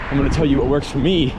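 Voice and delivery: ordinary delivery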